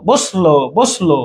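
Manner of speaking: slow বসলো, the s going straight into lo; correct pronunciation demo. Shown as a demonstration. Teacher repeating how the word really sounds, correct pronunciation demo. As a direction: say বসলো slowly with no vowel after the s. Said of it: "এরকম না".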